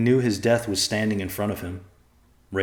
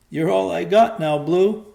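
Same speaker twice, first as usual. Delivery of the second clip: crying; 11 semitones higher, 8 dB louder than usual. A narrator's reading. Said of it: "still crying".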